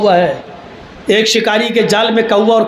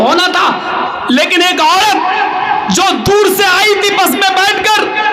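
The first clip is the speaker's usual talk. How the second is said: fiery preaching; 8 semitones higher than usual. Acted. Preacher rousing his brothers with the story of Ali Miyan Nadwi. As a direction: preach it full force to the crowd.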